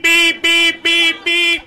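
high, loud honking noises; imitated car horn. Driver behind honking in traffic, shrieking horn imitation. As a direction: imitate insistent honking on one held high note. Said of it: "the guy behind me just starts beep".

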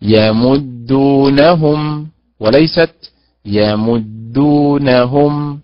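slow, careful stress demo; teacher demonstrating stress placement; correct vs wrong stress. Shown as a demonstration. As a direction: contrast the stress on يمدونهم slowly. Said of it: "والآية التي بعدها".

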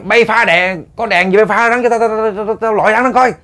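high-pitched urgent command to the crew; urgent shouted order. Retold or imitated. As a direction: order them urgently to shine the light. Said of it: "ổng nói". (giọng cao, hối thúc ra lệnh)

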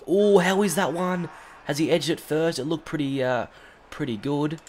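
high, loud eager appeal; hoping for the wicket; excited appeal. Real, not acted. Appeal for a catch.

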